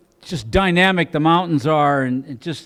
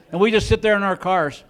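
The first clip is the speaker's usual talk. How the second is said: usual speaking voice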